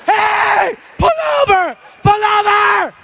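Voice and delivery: high, shrieking pull over; terrified passenger shrieking high at the cabbie; panicked yell. Retold or imitated. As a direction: scream at the driver in panic. Panicked yelling at driver.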